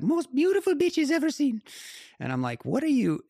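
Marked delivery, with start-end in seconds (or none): 0.0-1.6 very high, squeaky impression of an Italian climber; Simone Moro. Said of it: "Simone has this voice that is just epic". 2.6-3.2 baffled, high-pitched retort. Retold or imitated. Acting out his baffled reply.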